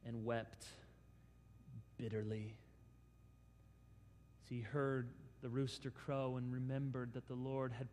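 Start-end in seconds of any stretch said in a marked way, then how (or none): 0.0-2.5 sorrowful reading. A narrator's reading. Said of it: "Luke's gospel says".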